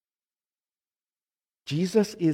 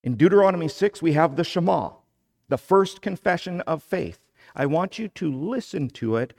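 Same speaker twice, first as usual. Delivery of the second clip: normal talking voice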